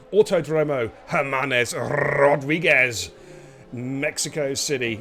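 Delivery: slow, drawn-out Mexican accent. Shown as a demonstration. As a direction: roll it out like a bad Mexican announcer. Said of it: "in my very worst Mexican accent there".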